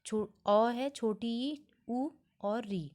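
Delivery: vowels spelled out slowly one by one; slow listing. Shown as a demonstration. Teacher listing the basic vowels to students, slow and careful. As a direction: lay each vowel out slowly for the class. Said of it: "ये चार हैं".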